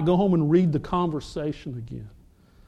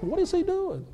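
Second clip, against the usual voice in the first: baffled; 11 semitones higher, 8 dB quieter than usual. Retold or imitated. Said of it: "I'm like".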